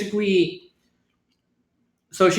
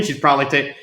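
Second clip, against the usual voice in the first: normal talking voice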